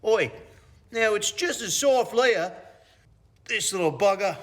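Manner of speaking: high, loud put-on Australian; mock Australian accent. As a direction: do a broad, hammed-up Aussie accent. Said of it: "an accent. ... you were doing an Australian there".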